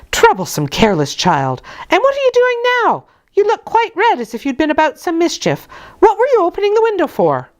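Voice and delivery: high, fast scolding nursemaid; scolding nurse. Acted. Nurse Bessie berates Jane at the window, high and fast. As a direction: berate the careless child.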